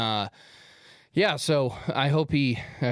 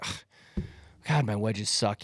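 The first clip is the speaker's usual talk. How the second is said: mild, weary grumble with a breathy ugh; retold griping; 4 semitones lower, slower than usual. Golfer moaning about his wedges to his friend, mildly disgusted. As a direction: sigh it out, mock-discouraged. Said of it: "he's kinda like".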